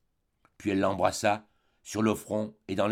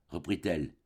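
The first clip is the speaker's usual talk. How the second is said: ordinary delivery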